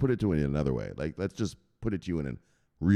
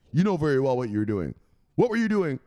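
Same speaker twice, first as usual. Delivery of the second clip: higher, rising mock-stern rebuke; scolding boss; 5 semitones higher, 4 dB louder than usual. Boss catching an underling at the ledger, scolding. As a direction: build from stern to high interrogation.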